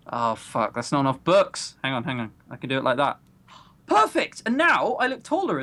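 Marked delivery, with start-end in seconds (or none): 3.9-5.6 bright pleased cry; excited. Real, not acted. Finally fixing the camera, a delighted perfect. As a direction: jump up at the result.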